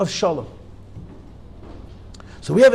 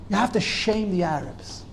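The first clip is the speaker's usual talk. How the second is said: normal talking voice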